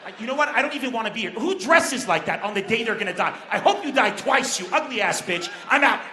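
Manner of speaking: hammed-up quarrel building to a shout; mock angry rant. Woman quarrels with Leslie and quits, mock angry rant. Acted quarrel between women bombers.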